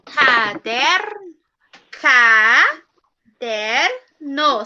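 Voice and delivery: Caderno stretched slowly, syllable by syllable; syllables drawn out. Shown as a demonstration. Teacher counting syllables for the class, slowly drawn out. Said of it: "São três sílabas e eu quero com quatro".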